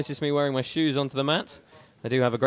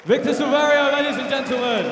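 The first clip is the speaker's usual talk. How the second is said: high, booming fighter introduction, much louder; announcer introduction; 10 semitones higher, 9 dB louder than usual. Real, not acted. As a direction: sell the name to the crowd.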